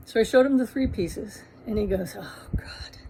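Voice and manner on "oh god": exasperated whisper. Retold or imitated. Husband sees the prices.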